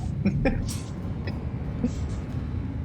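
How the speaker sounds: unvoiced giggle, high; breathy giggle. Real, not acted.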